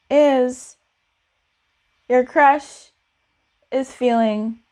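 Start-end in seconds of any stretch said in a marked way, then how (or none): none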